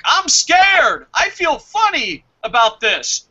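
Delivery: hammed-up panic, starting on a high yell; mock scared.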